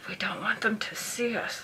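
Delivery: whispered, secretive pilot over the radio; hushed radio guy. Retold or imitated. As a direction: whisper it as if trying not to be noticed. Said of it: "what the guy said over the radio".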